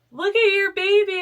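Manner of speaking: put-on bright, loud look at your baby; excited high call. Nurses presenting baby.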